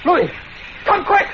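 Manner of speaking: shouting for help upstairs; urgent call. Acted. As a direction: call him quick in alarm. Calling for help in alarm.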